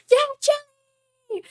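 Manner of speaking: long, very high, loud cheer; drawn-out cheer from a proud mom. Retold or imitated. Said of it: "she'd be like".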